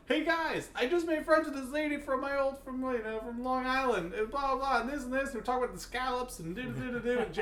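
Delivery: high-pitched, babbling reenactment of an excited greeting; excited chatter. Retold or imitated. Drinker telling waiting friends about a new friend, excited chatter. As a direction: burst in chattering, high and oblivious.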